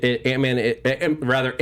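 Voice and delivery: usual speaking voice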